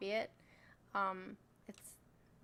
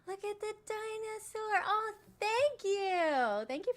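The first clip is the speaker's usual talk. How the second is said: gasping delight; 10 semitones higher, 3 dB louder, slower than usual. Real, not acted. Gasps over gift in live chat.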